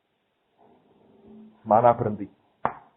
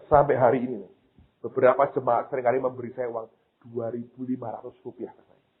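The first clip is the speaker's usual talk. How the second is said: usual speaking voice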